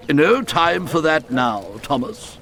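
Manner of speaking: a lightly voiced stern boss cutting in, slow; brisk interruption. A narrator's reading. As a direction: cut him off, lightly bossy. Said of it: "the fat controller interrupted".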